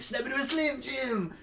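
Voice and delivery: high, mock wrestler catchphrase yelled out; shouted Macho Man line. Mocking the teacher mascot at the pep rally, Macho Man yell. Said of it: "so I started shouting".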